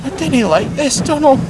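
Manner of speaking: anxious Scottish whimper. Acted. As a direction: fret to your brother, scared. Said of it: "whimpered Douglas".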